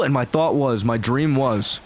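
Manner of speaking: normal talking voice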